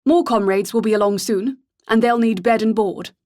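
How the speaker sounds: put-on educated English accent, commanding; clipped educated accent. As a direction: announce it like a posh officer. Goon voiced throughout.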